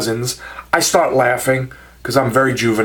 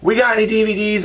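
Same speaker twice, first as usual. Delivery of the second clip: put-on light Southern accent, pitched up and quick; rough attempt at a Southern accent; 8 semitones higher, faster than usual. Wife yelling to her husband, imitated Southern accent. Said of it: "They had, like, a southern accent".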